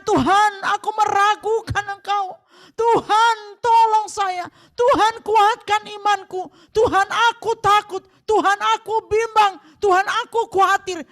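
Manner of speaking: loud pleading prayer; crying out to God. Shown as a demonstration. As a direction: cry out your fear to God. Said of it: "ketika kita berteriak seperti itu".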